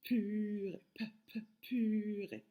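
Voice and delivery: long "puuu-rée" broken by two popped "p" sounds; isolated P sound. Shown as a demonstration. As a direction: pop "p, p", then stretch the "pu" of "purée". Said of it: "le P qui fait le son p".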